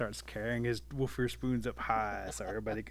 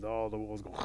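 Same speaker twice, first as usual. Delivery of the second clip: ordinary delivery, nothing marked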